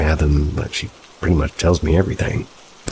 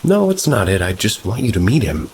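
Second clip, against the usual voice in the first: high-voiced, mildly upset girlfriend; a bit upset; 6 semitones higher than usual. A narrator's reading. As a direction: plead a little, pitched up at the start. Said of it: "She gets a little bit upset and says".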